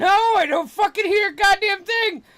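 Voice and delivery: very high, loud hollering outburst; exasperated yelling. Acted. Player answers whether they hear it, exasperated yelling. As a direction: yell it back at full volume. Acted shouting answer to "Listen.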